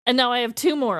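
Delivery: ordinary delivery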